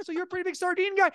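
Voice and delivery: high, fast faux-interested question; mock curious. Guest asking the dad about sardines, high and fast. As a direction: egg him on with fake curiosity. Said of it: "I'm like".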